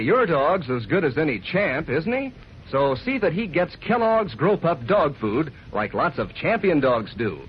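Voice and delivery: ordinary talk, nothing marked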